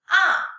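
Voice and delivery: "ah" short, loud, falling from high; fourth tone. Shown as a demonstration. Teacher leading read-after-me tone drill, falling "ah". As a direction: drop "ah" sharply from the top. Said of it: "the tones".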